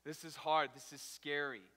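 loud, hammed-up alarm at a scary drive; loud mock fright over a stalling truck; mock panic. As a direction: act out the panic, half-joking. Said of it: "It's like".

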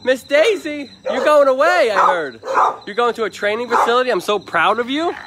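high, loud, gushing praise for the dog; excited dog talk. Real, not acted. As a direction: beam at the dog like a proud parent. Talking to the shelter dog.

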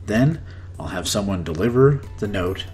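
ordinary talk

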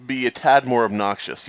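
normal talking voice

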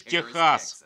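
normal talking voice

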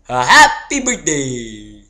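loud, slow, drawn-out cheer; loud slow festive greeting; cheery shout. Pretend. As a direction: call it out like a party toast. Cheers with toy Gus.

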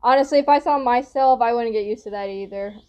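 usual speaking voice

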